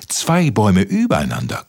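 ordinary delivery

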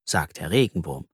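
plain reading voice

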